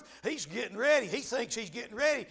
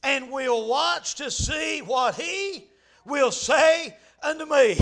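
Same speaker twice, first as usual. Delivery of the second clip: slow scripture reading; slower than usual. Shown as a demonstration. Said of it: "he says this".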